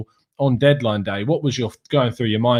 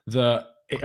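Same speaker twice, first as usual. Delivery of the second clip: normal talking voice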